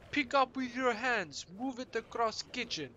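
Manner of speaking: very high mock Slavic accent, bossy; thick accent. Kitchen boss tells boy to lift flour, thick accent. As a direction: issue orders in a high, thick Eastern European accent. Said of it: "thick accent".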